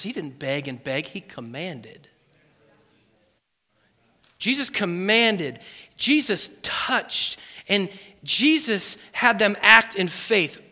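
ordinary delivery

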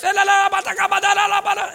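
high, loud babbled syllables on one pitch; imitated tongues. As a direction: imitate a woman bursting into tongues. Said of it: "she starts".

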